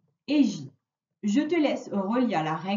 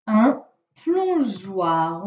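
slow dictation; 3 semitones lower, 4 dB louder, much slower than usual. Shown as a demonstration. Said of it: "Le premier mot".

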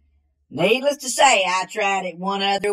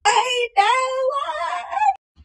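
panicked yell; much slower than usual. Retold or imitated. Man watching his car roll away, panicked high yell. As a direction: yelp it out in alarm. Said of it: "and I was like".

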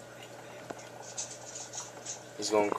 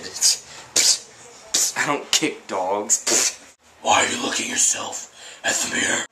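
hissed stage whisper; 3 dB louder than usual. Acted. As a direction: whisper it close to the mic. Whispered asides to camera.

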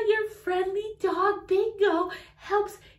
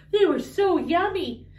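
ordinary talk

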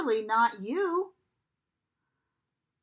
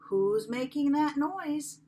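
usual speaking voice

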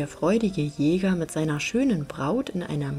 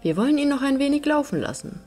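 quick, higher-pitched storybook reply; confident reply; 8 semitones higher, 4 dB louder, much faster than usual. A narrator's reading. Hunter answering his master about the running hare, quick and confident.

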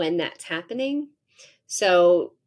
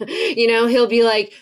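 normal talking voice

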